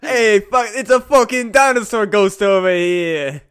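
loud New York tough guy voice; mock New York accent, loud. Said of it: "like, New York".

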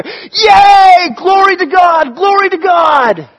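high, loud cheering; joyful angel shout. Acted. Crowd of angels praising God, joyful shout. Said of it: "all of them singing together".

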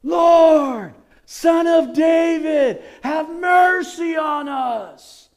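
high, loud, slow drawn-out cry. Retold or imitated. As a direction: stretch out the plea for mercy. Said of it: "They got louder".

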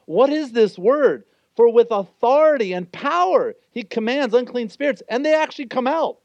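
the synagogue crowd marvelling at Jesus' authority; amazed crowd. Acted. Crowd amazed, voice climbing in wonder. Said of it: "they were all amazed".

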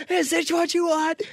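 high, slow mock tantrum question; Steve going bananas. Steve ripping things up, asking in a high slow wail. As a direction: whine it high and drawn out. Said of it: "he's like".

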